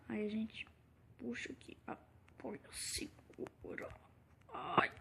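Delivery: shivering with cold; quiet, shaky from the cold. Real, not acted. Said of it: "Ai, que frio, cara".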